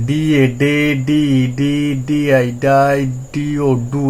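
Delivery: letter by letter into the syllable; spelled-out reading drill. Shown as a demonstration. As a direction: spell ডি, then say ডে, ডি, ডাই, ডু. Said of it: "তাহলে একইভাবে". (বানান করে উচ্চারণ)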